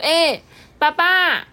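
a small boy, voiced lightly; little boy. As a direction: call out to dad eagerly. Little boy greets father.

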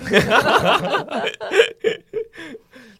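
high loud falling laugh; big laugh after a joke. Real, not acted.

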